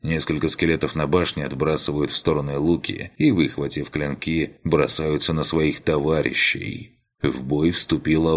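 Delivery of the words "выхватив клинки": ordinary delivery, nothing marked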